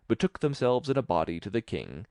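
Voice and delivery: ordinary delivery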